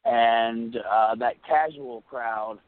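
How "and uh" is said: ordinary talk, nothing marked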